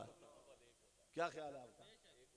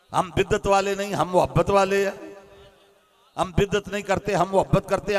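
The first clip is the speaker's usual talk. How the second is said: loud, rhythmic preaching chant; rising sermon; 13 dB louder than usual. Acted. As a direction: hammer each line home to the gathering. Preaching at full force. (ऊँची आवाज़ में जोशीली तक़रीर)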